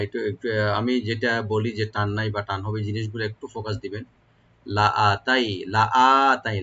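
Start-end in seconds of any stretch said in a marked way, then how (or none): 4.7-6.6 লাআতাই with short লা and a one-alif stretch on আ; tajweed demo. Shown as a demonstration. Teacher drilling students on the elongation. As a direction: say লাআতাই drawing out the second syllable. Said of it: "লামে টান নাই".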